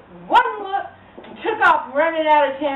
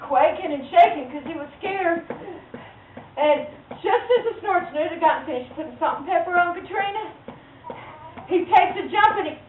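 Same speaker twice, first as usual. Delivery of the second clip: ordinary delivery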